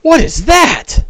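gaping at a monster; startled Bing. Acted. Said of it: "Bing kinda looks and goes".

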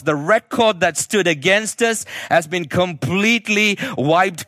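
preaching at full force. Acted. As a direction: proclaim it like the gospel's great news. Preaching the gospel to congregation.

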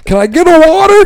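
very high, loud whiny demand; mocking lady customer. Bartender mocking the lady in the corner, very high loud whine. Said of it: "the lady in the corner going".

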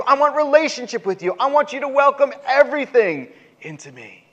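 high, fervent appeal; God, pleading. Acted. God asking the people for closeness, fervent high plea. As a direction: plead earnestly, pitched well above normal. God's earnest plea, acted.

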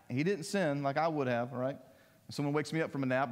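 ordinary talk, nothing marked

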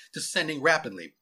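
plain reading voice

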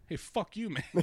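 high-pitched mock snottiness; playfully rude jab; hint of shit. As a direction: say it with mock attitude, half laughing. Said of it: "slightest hint of shit in your voice".

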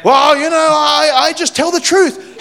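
mocking imitation. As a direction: parody a know-it-all insisting on honesty. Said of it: "That's obnoxious".

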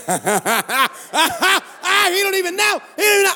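high, giddy acted laughter; laughing out loud. Man texting back in bed, acting out giddy laughter. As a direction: squeal it through put-on laughter. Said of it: "but I'm laughing, literally, L O L, out loud".

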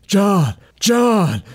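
calling out urgently. Acted. Tina urgently calls John.

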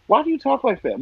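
high-pitched mock disbelief; incredulous. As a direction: ask in exasperated bafflement. Retold disbelief at senators' drawl.

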